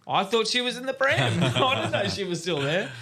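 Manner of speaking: high, loud, laughing through the words; dad explaining the mix-up to his wife, laughing; laughing protest. Retold or imitated. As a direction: plead innocence while cracking up.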